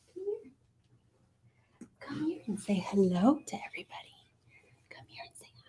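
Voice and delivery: whispering. Real, not acted. Coaxing the cat quietly.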